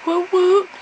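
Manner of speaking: high, loud whoop for a friend; excited cheer. Real, not acted. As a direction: cheer her on like a fan.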